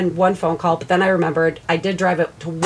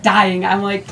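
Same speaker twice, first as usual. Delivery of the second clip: usual speaking voice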